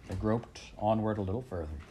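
ordinary delivery

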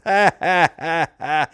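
loud, high mock guffaw; big spelled-out ha-ha, acted; imitated burst of laughter. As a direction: mimic someone busting out laughing. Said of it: "busts out laughing 'cause he just goes".

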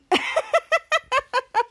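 high, loud staccato laugh; imitated group laughter. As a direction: imitate kids cackling in quick pulses. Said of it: "And they laughing".

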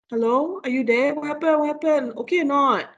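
wife calling out. Retold or imitated. Wife calling to her husband in the dark, worried. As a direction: call out to a husband who's gone silent. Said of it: "She was like".

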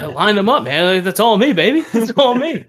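hammed-up loud cocky brag; mock boasting. Golfer imitating a friend who brags he lined up the putt. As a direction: puff up and claim all the credit. Mimicking buddy taking credit.

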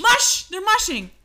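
playful mush call. Pretend. Playful sled dog command.

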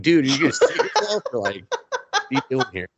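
fast, high, giggling taunt; laughing mock heckle. Viewer heckling the awkward boy, giggling and high. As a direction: heckle him while laughing. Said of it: "And I'm like".

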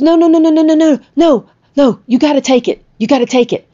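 high, loud, rapid-fire overruling; urgent insistence. Retold or imitated. Friend presses money on woman, insisting.

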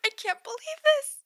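high, loud fangirl squeal; squealing excitement. Acted. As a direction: shriek it with glee. Text repeated in building excitement.

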